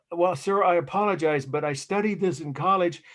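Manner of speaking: retold meek plea to a stern guard; pathetic pleading. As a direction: plead politely, a little pathetic. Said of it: "pathetic pleading".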